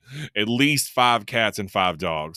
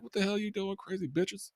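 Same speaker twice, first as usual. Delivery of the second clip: much higher, softer mock-baffled yelp; imitating Big Al; 11 semitones higher, 10 dB quieter than usual. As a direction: squeak it like a startled big guy being attacked. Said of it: "he's just like".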